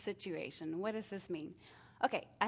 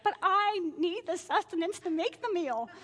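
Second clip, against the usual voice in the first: mock excuse; 9 semitones higher, 7 dB louder than usual. Justifying sneaking food.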